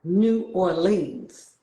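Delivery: "New Orleans" drawn out slowly, New York style; New York accent. Shown as a demonstration. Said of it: "So we say things like".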